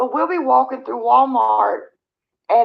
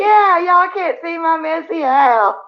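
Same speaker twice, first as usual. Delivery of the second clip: louder, higher, slowed and playful; joking relief; 7 semitones higher, 6 dB louder, slower than usual. Real, not acted. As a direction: tease the viewers, relieved they can't see. Joking about the blurry stream.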